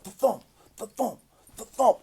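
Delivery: mouthed car thumping over bumps; punchy "thuh-thump" sound effect; imitated speed-bump thumps. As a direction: make the car bounce with your voice.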